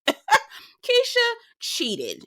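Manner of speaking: high, very slow laughing drawl; laughing realization. Real, not acted. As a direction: savor it with a laugh.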